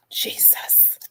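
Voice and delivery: breathy whispered aside; hushed praise of the scent; whispered Jesus. Real, not acted. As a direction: whisper it in delight. Reacting to custard smell.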